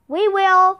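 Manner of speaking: calling back. A narrator's reading. Jack answering mom on his way out, called. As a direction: call it back across the house. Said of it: "called Jack".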